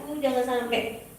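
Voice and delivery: ordinary talk, nothing marked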